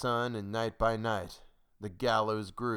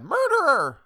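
very high, loud scream of accusation; piercing accusing yell; crying out; 11 dB louder than usual. A narrator's reading. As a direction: shriek the accusation from the crowd. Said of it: "Then one cried".

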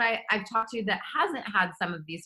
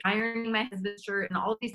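usual speaking voice

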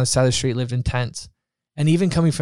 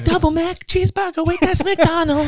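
hammed-up ad voice, much higher; mock commercial; 13 semitones higher than usual. Guest joking about a Wendy's ad in a silly ad voice. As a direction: sell it like a goofy fast-food ad. Mock fast-food ad joke.

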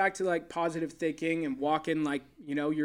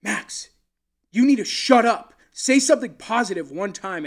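sudden loud, higher snap at his player; snapping angrily; 5 semitones higher, 10 dB louder than usual. Retold or imitated. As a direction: bark it out as if losing it on him. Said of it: "kinda snapped at him".

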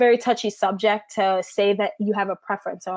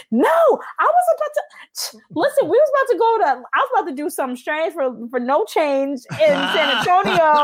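much higher squeaky, breathy start, rushing; mock exasperated protest; 7 semitones higher than usual. Telling husband she had plans, high mock-exasperated rant. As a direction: squeak out the protest in comic disbelief.

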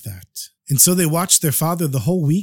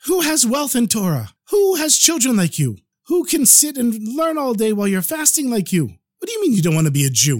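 incredulous, much higher-pitched protest; wife freaking out; 7 semitones higher than usual. Acted. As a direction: protest in high-pitched disbelief at your husband. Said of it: "His wife was freaking out".

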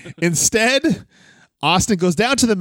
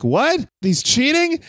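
mock surprise; 9 semitones higher, slower than usual. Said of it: "he's like".

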